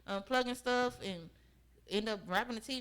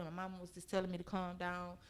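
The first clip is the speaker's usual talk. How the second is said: ordinary talk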